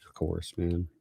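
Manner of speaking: hushed, sincere whisper; soft whisper. Real, not acted. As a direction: breathe it quietly to the viewer. Thanking viewer for prayers request.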